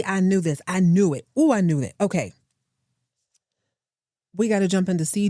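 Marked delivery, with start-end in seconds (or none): none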